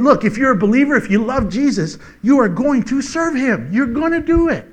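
high, loud insistent preaching; preaching at full force. Acted. Preacher voicing Paul insisting believers will serve, forceful. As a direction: press the point home.

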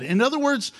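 ordinary talk

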